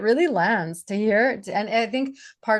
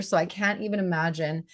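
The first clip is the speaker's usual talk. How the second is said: normal talking voice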